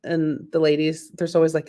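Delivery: ordinary talk, nothing marked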